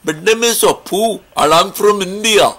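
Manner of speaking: imitating Apu with an Indian accent. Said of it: "he talked like this".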